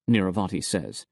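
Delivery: usual speaking voice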